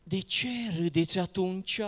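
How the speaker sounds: normal talking voice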